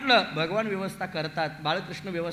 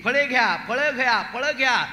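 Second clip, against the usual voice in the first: raised, repeated street-seller call; loud vendor's cry; 4 semitones higher, 6 dB louder than usual. Acted. Fruit seller hawking through the village, loud vendor's cry. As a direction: call out your wares loudly. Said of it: "मोठमोठ्याने ओरडत निघालेली होती".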